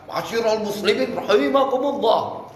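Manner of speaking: high, energetic speech opening; confident memorised address. Shown as a demonstration. Coach shows how a memorised speaker greets the crowd, confident. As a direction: open the speech boldly, pitched high. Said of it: "Tapi kalau dia hafal".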